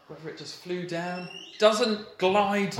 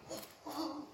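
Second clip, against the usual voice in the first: much higher but softer giddy exclamation; a dad too thrilled for words; giddy whoa; 10 semitones higher, 13 dB quieter than usual. Pretend. Father hearing his child wants baptism, giddy whoa. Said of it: "I'm gonna be like".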